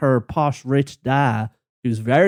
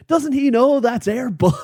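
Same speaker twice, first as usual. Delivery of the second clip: a higher mock voice of a guy off camera; 11 semitones higher than usual. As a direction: imitate the off-camera guy, pitched up. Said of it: "some guy off camera going".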